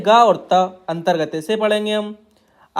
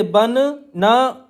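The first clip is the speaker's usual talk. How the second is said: बनना dragged out very slowly; बनना spoken at a crawl; stretched dictation; much slower than usual. Shown as a demonstration. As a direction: hold बनना long while writing it. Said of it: "एक और ना चाहिए इस तरीके से".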